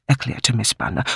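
plain reading voice

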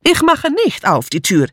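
put-on high, defiant foreign-accented call. A narrator's reading. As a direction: call it from another room. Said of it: "rief Mitzi aus der Küche".

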